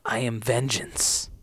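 lightly voiced low, slow Batman; a hint of gravel-free brooding hero. Imagining Jonathan as Batman, low and slow. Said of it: "imagine if Jonathan said".

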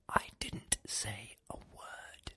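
barely breathed, slow and secretive; hushed whisper. Acted. Madman under the table whispers, hiding from the Inquisitor. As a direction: whisper so the Inquisitor can't hear.

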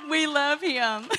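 fond words between peals of laughter; laughing through it. Real, not acted. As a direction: say it while still laughing. Laughing after naming Luke.

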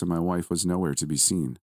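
ordinary delivery, nothing marked

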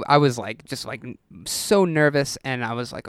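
usual speaking voice

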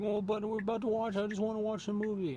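high-pitched whiny kid imitation; mock whining. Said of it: "he starts whining like this".

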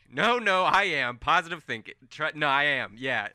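loud, high denial; stubborn insistence. Acted. Colm loudly refusing Farron's warning, high and insistent. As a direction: insist cheerfully over her.

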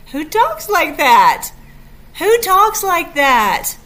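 loud, slow and high, marvelling; who talks like that, said twice with loud wonder; amazed exclaim. Real, not acted. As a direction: exclaim it in delighted disbelief. Amazed at loving words.

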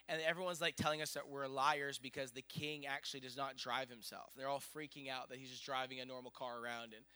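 ordinary talk, nothing marked

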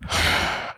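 long unvoiced sigh; heavy sigh. Real, not acted. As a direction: let out a weary breath. Painful memory of abortion.

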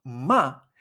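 "Ma" short with a sharp fall; fourth tone. Shown as a demonstration. Teacher giving the word for insult, "Ma" falling. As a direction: drop "Ma" dryly downward. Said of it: "M A con cuarto tono".

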